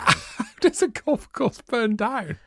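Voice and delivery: high, slow question through laughter; laughing. Real, not acted. Co-host baffled by a burnt golf course, laughing. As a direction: struggle to get the question out, laughing. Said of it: "I don't know why I'm laughing".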